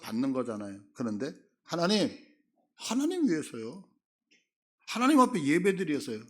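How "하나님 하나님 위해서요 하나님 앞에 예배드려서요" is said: high, self-righteous excuse; brazen excuse. Retold or imitated. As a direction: protest your innocence proudly. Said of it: "너무너무 당당한 거예요".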